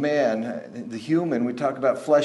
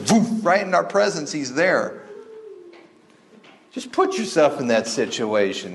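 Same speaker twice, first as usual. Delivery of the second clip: ordinary talk, nothing marked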